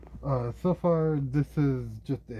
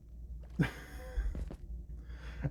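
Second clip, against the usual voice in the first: soft laugh; 3 semitones higher, 7 dB quieter than usual. Real, not acted. Laughing at swimming in sewage.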